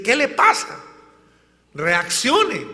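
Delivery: high, loud "¿Qué le pasa?"; exasperated shout. Acted. Telling someone bewitched to wake up, exasperated shout. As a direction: snap them out of it. Shaking someone awake from delusion.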